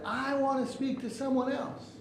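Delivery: high-pitched acted demand; angry customer. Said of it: "He's yelling".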